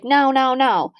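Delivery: a mild mock refusal from mom; Jody. Mom saying no to the puppy, mildly imitated firm refusal. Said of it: "she was like".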